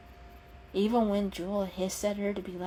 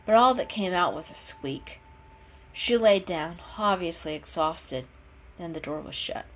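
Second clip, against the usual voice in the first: plain reading voice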